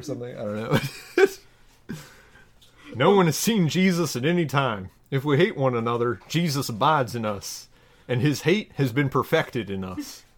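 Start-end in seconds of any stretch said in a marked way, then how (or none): 2.9-10.2 measured, solemn Bible reading; scripture reading. Acted. Reading a parody Bible passage to listeners, solemn scripture voice. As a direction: read it like a pulpit lesson.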